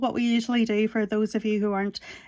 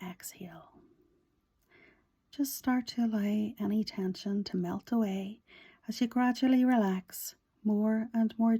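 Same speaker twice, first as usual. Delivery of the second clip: guided meditation; 5 dB quieter than usual. Real, not acted. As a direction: guide breathing gently and quietly, leaving space after each phrase.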